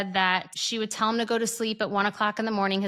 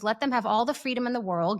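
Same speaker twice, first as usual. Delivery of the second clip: ordinary talk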